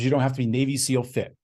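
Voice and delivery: usual speaking voice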